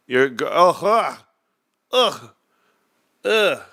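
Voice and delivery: a run of high "ugh" groans of disgust. Real, not acted. Reacting to French accents with disgusted ughs. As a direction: groan as if something slimy touched you. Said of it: "It feels gross".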